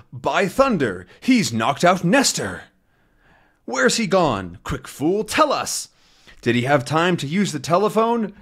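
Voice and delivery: a lightly played thug demanding answers; a hint of a rough crook; gruff gangster. Voicing the gangster.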